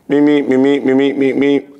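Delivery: high sing-song mock phone ring; imitated phone ring. Waking to the phone, ringing high.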